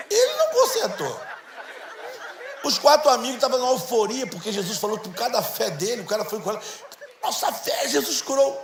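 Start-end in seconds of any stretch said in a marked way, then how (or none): 0.1-1.2 laughing. Real, not acted. Laughing at the healed man leaving. 7.2-8.6 high, breathless cheering; excited friends. Retold or imitated. Voicing the four excited friends.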